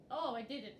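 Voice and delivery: ordinary talk, nothing marked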